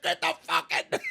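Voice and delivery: high, loud mock-frantic yell; high-pitched holler through amusement; retold yelling. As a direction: imitate a buddy yelling for the net. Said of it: "He was yelling at me".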